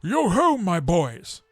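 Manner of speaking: hearty, booming, slow jolly call; a fat merry master calling his boys; jovial Fezziwig. A narrator's reading. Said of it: "said Fezziwig".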